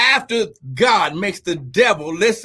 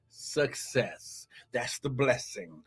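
much lower and softer, almost spoken aside; soft drop; 9 semitones lower, 14 dB quieter, slower than usual. Real, not acted. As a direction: let the word fall away softly. Congregation fills in, preacher drops.

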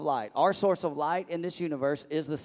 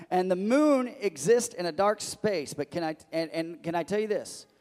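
louder, higher, building sermon voice; preaching at full force; 4 semitones higher, 5 dB louder than usual. Acted. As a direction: drive it home to the congregation.

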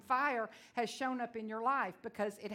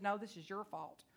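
calm voice of the Spirit; 4 dB quieter than usual. Retold or imitated. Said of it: "audible voice said".

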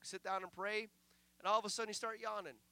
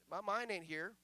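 normal talking voice

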